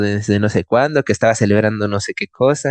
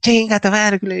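mock yell; 9 semitones higher than usual. He repeats her drunken curse at the taxis, a little milder. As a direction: rail at the taxi again.

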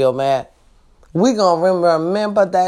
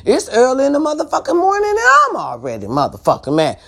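higher, fast, fired-up complaint; fast and heated, higher in pitch; irritated rant; 7 semitones higher, faster than usual. Real, not acted. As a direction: let the irritation boil over quickly. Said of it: "I'm already irritated".

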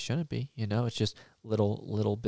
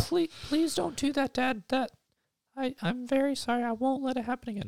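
put-on high childish voice, rising to a plea; pleading little boy; 11 semitones higher than usual. Billy apologizing to his angry dad in a high kid voice. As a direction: beg like a scared small boy.

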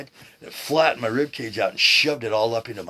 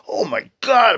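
loud, high cry of shock, acted out; retold pained exclamation; 14 semitones higher, 11 dB louder, slower than usual. Man retelling pain across his chest, acted shocked outcry. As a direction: relive the shock, voice jumping up loud.

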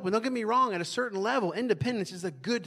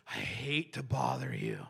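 much lower, slow, sheepish "I hate to bother you"; over-apologetic; 4 dB quieter, slower than usual. Retold or imitated. Preacher mimicking people asking for favors, over-apologetic. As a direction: cringe as you ask. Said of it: "how over-apologetic we are".

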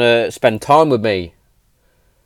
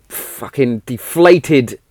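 ordinary talk, nothing marked

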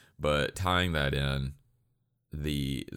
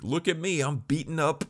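high-pitched, showboating boast; hammed-up, high gloating voice; mock bragging bully; 8 semitones higher than usual. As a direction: imitate a bully crowing over his win. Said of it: "that was a guy who was just like".

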